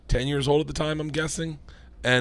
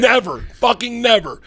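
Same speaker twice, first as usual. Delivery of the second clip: high, loud, hammered-out never; emphatic shout; 9 semitones higher, 9 dB louder than usual. Real, not acted. Comedian swearing the kid will never call home, emphatic shout. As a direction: punch each never as the climax. Climax of the comic rant.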